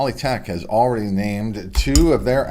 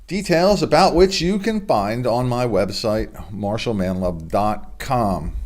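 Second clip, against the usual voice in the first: sponsor ad read; 4 semitones higher than usual. Acted. Host plugging his own hypnosis show to viewers, upbeat ad read. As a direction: sell the hypnosis show like a radio spot.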